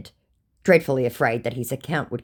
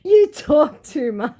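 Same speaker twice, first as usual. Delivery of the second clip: mocking scammer voice; 14 semitones higher, 6 dB louder, slower than usual. Reading the scammer's reply.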